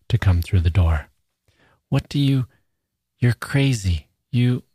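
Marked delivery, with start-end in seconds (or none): 1.9-4.6 high, faltering protest; frightened, higher-pitched half sentences; panicked, broken off. A narrator's reading. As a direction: break off as panic rises. Panicking girl stammers at intruder.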